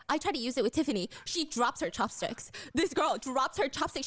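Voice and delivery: ordinary talk, nothing marked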